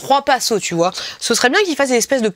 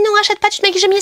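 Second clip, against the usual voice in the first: high-pitched mock voice of conscience; inner voice objecting; 10 semitones higher, much faster than usual. Inner voice talking her out of buying, high mock protest. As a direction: argue against it in a high put-on voice. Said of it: "quelqu'un me disait".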